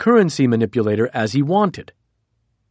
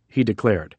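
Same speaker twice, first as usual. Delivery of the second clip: ordinary delivery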